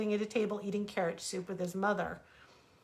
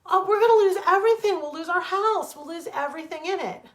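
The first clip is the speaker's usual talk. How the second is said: high, much louder, rushed begging; frantic plea; 9 semitones higher, 10 dB louder than usual. Acted. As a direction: tumble the words out in panic. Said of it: "cried the rabbit again".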